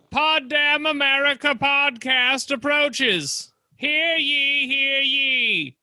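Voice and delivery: high, slow herald call; town crier. Pretend. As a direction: proclaim it like a medieval crier. Mock herald announcing the show.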